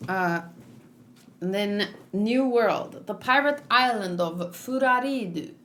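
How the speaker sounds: ordinary delivery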